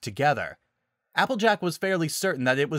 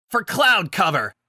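battle cry; 7 semitones higher, 6 dB louder than usual. Acted. Battle cry mid-fight.

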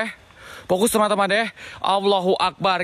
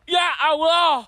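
high, startled wail to God; alarmed cry; 12 semitones higher, much slower than usual. Real, not acted. Ghost hunter startled after being touched, high alarmed cry.

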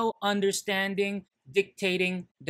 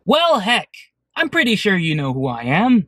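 loud formal driver; 10 dB louder than usual. Acted. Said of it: "that little skit I just thought up".

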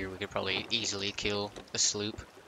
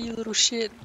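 high, louder mock outrage; mock yell; 13 semitones higher, 6 dB louder than usual. Picking a fight with the kraken in a high mock yell. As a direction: shriek it like an offended brute. Acting hostile quote.

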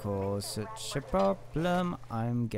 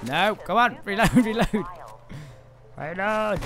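panicking gamer; 8 semitones higher, 8 dB louder than usual. Real, not acted. No ammo, enemies close.

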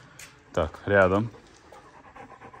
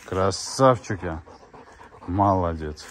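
usual speaking voice

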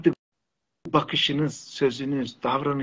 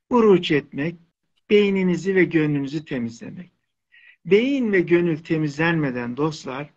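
usual speaking voice

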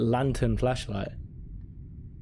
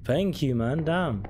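ordinary talk

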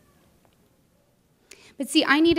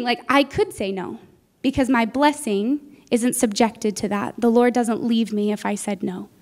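ordinary delivery

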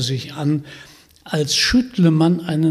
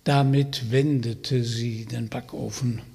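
ordinary delivery, nothing marked

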